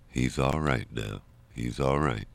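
soft, low and slow; quiet reassurance. Retold or imitated. Mother left knowing her son was safe, quietly reassured. Mother's last relieved thought, retold.

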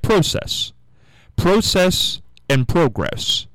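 process and progress both starting "pro"; long-o pronunciation. Shown as a demonstration. Host arguing from the root word, long-o process and progress. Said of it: "that's how you get process".

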